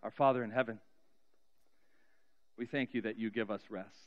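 ordinary talk, nothing marked

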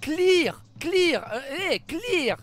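angry shouting. Real, not acted. Raging at the game.

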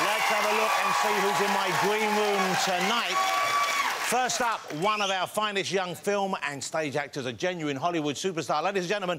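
high, energetic chat-show introduction; show announcer. Acted. Host announcing guests to the audience, big announcer voice. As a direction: build to the star's name, stretching it out.

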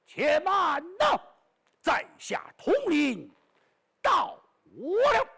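slow, high, loud heroic call; thundering shout. Acted. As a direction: boom the hero's entrance from above. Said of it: "霹雳一声响啊".

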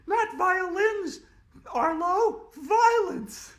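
put-on very high, loud bossy little girl; girl corrects a classmate, high and bossy; snooty know-it-all girl. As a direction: squeak it out, correcting him with scorn. Andrea corrects Arlo.

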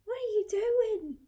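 very high squeak at the dog; squeaky pet voice. Real, not acted. Asking the dog what he's doing, very high squeak.

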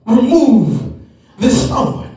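preacher acting out Paul's desperate plea; pleading prayer. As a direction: beg God on your knees, voice rising. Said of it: "he prayed might have gone a little bit like this".

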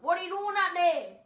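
loud, high yell at the bird. Retold or imitated. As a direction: shout up at the bird angrily. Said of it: "So I yelled at him".

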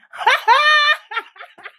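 a loud, high cackle at her own joke; high cackling laugh. Real, not acted. Laughing at her own dirty birthday joke.

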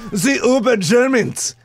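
put-on high, drawn-out German accent. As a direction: announce it in a mock German accent. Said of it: "I could do a German accent".

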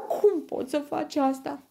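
very high, loud whimper; yelping whine. A narrator's reading. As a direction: whine like a hurt pup. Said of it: "scheaună el". (scheunat ascuțit)

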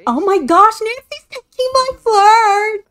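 real giddy excitement; excited squeal. Real, not acted. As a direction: squeal at the screen. Excited reaction to game.

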